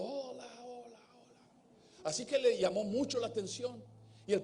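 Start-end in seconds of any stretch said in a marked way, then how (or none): 0.0-2.0 fading, distant echo repeats; faraway echo fading out. Retold or imitated. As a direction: let each hola die away into the distance. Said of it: "Y el eco le respondió".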